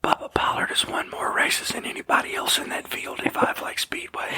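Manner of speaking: unvoiced, creepy close whisper; creepy Joe Biden whisper. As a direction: breathe it like a creepy old politician. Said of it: "my Joe Biden creepy whisper voice".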